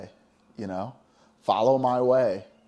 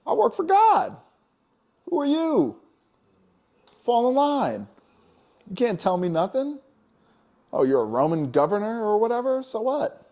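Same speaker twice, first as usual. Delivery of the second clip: much higher, louder voice, defiant and furious; furious Yeshua; 12 semitones higher, 5 dB louder than usual. Retold or imitated. Yeshua confronting the temple gamblers, high furious defiant shouting. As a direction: tear into them like a prophet flipping tables. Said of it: "And he was like".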